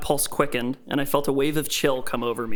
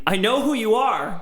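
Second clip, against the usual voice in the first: high, loud defiant shout; 9 semitones higher, 5 dB louder than usual. A narrator's reading. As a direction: shout it back at your captor. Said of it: "I shouted back at the screen".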